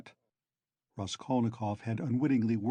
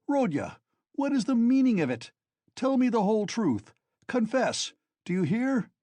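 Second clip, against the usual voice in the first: much higher, loud furious scolding; angry; 8 semitones higher, 4 dB louder than usual. A narrator's reading. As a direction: berate him like a furious friend. Razumihin angrily confronting Raskolnikov.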